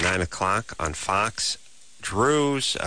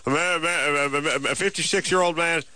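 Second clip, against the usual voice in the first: higher, rushing screener voice, getting faster; impatient screener; 6 semitones higher than usual. Retold or imitated. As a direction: blurt it out like a hurried call screener. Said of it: "They're just like".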